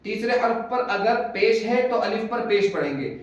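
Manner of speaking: ordinary talk, nothing marked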